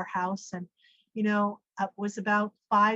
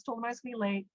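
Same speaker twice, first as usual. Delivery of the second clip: ordinary talk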